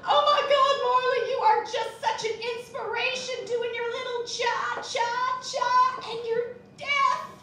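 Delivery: shrieking high starstruck fan; gushing fan. Retold or imitated. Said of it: "she was like".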